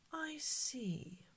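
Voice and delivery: soft whispered aside; muttered. Acted. Shopkeeper murmuring after seeing the empty purse, whispered. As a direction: murmur it under the breath.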